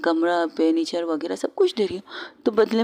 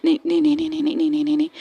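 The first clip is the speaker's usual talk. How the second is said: high, rapid panicked refusals; a flustered girl backing away, squealing in panic; 8 semitones higher, much faster than usual. Acted. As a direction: squeal no over and over in alarm. Panicked after long breath.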